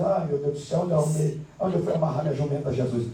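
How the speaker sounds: low, soft grumbling to himself; hushed, low mock lament; muttered lament. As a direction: mutter a weary plea under your breath. Retold muttered prayer to himself.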